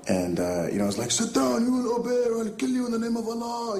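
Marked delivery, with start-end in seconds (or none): 1.1-3.8 very high, hammed-up villain threats; wildly overacted terrorist audition read; over-the-top terrorist. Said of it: "read my lines way over the top".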